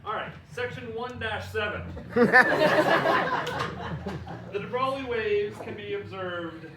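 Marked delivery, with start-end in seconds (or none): 1.9-4.5 a real laughing burst; loud giggle; loud laughter. Real, not acted. Laughs at section heading.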